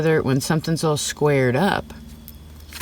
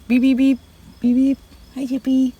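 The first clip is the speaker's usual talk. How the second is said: much higher squeaks, beeping at a tiny pet; squeaky pet talk; 11 semitones higher than usual. Real, not acted. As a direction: chirp at the animal like a little toy.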